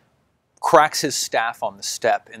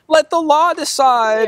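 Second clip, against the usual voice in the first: mock zealot; 5 dB louder, slower than usual. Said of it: "you're like".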